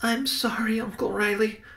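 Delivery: put-on soft, high little boy's voice; whispered apology. A narrator's reading. Benny apologizes to his uncle, hushed and high. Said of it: "Benny whispered".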